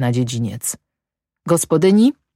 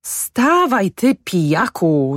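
high cry that drops into a slow rebuke; acted scolding call, drawn out at the end; wife calling out; 6 semitones higher, slower than usual. A narrator's reading. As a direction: order your drunken husband up. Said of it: "zawołała kobieta do męża".

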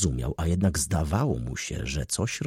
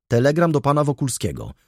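higher-pitched public call; 7 semitones higher, 4 dB louder than usual. A narrator's reading. Conductor announcing a telegram at the train carriage, calling out. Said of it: "zawołał".

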